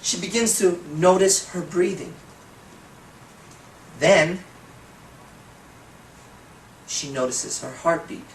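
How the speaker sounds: slow, lingering hypnotic delivery; slow trance voice. Shown as a demonstration. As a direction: let each phrase sink in slowly. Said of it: "if I'm talking like this".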